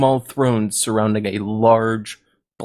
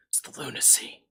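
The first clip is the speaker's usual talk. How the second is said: whispered, much softer; 12 dB quieter than usual. Acted. Uncle Ben answers Parker in a hushed whisper. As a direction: breathe it out like a secret. Uncle Ben's deadpan reply.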